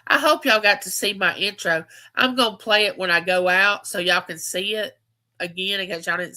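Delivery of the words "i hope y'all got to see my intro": ordinary delivery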